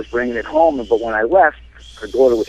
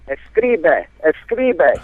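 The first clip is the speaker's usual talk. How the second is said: imitating grandma; 5 semitones higher, much slower than usual. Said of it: "she starts saying".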